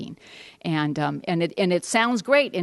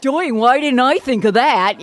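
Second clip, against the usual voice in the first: higher, louder, slow dripping sarcasm; sarcastic; 6 semitones higher, 7 dB louder than usual. Retold or imitated. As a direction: fake amazement, fully sarcastic. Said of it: "I'm just like".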